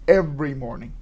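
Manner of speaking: ordinary delivery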